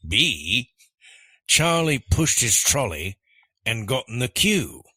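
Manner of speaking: emphatic put-on Australian accent. Reading answer choice B on request, Australian accent. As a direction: play up an Australian accent for the quiz. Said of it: "read these choices in your best Australian accent".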